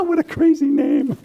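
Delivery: high, loud, laughing exclamation; laughing hard. Acted. Artist cracking up over a crazy name, laughing. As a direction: squeal it through laughter.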